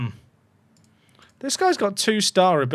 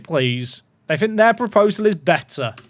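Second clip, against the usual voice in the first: normal talking voice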